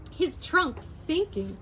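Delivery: ordinary delivery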